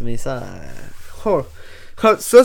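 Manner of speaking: usual speaking voice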